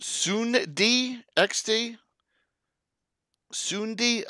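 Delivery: "Sundi" sounded out slowly and high as "SOON-dee", testing the name; puzzling over pronunciation. Shown as a demonstration. Player sounding out the place name "Sundi" in his South Jersey accent, slow and high. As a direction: drawl "Sundi" up high like a question, trying the place name aloud. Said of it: "my South Jersey accent and hack everything apart".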